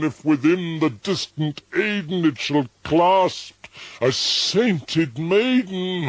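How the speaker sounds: high, loud, breaking into a cry; desperate plea. A narrator's reading. Narrator begs to know if he'll hold Lenore, near tears. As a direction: beg with tears in the voice.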